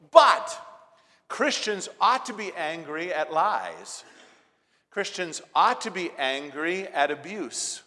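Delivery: hammering preacher cadence on ought; preaching at full force. Acted. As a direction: drive each line home from the pulpit.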